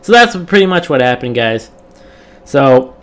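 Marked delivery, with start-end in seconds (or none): 2.4-3.0 after a burp. Real, not acted. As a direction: talk through a burp. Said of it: "God, I'm burping like crazy".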